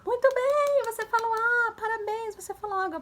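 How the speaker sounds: put-on high-pitched cheering for a small child; delighted praise. Shown as a demonstration. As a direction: celebrate a toddler's first word, sing-out high. Models praising child's first word. (elogio animado, bem agudo)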